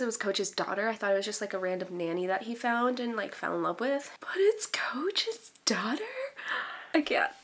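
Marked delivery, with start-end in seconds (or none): 4.2-7.3 high, slow, breathless disbelief; incredulous. Real, not acted. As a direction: stretch it into a scandalized question. Mock shock at the plot twist.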